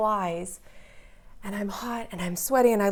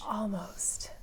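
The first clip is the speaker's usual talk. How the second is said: soft unvoiced whisper; 9 dB quieter than usual. Real, not acted. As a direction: breathe it out quietly. Soft answer to interviewer.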